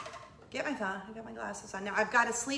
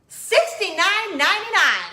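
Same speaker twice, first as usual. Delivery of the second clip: louder and higher, stunned at the price; shocked price read; 9 semitones higher, 12 dB louder than usual. Real, not acted. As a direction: blurt out the price in disbelief.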